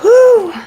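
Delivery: loud high whew; relieved exhale. Real, not acted. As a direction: blow out a big breath. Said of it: "I'm out of breath".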